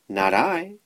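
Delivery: high, purred-out cat reply; alley cat. A narrator's reading. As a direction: purr it like a lazy cat. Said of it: "purred the cat".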